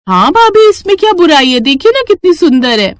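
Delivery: high, eager pleading; bright and eager, pushing back; excited insistence. Acted. Bride insisting to sister-in-law on the heels, high and eager.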